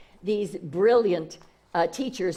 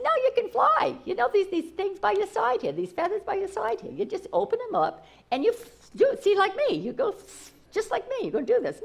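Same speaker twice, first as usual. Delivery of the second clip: coaxing big eagle; 10 semitones higher than usual. Acted. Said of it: "And he says".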